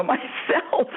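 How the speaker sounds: ordinary talk, nothing marked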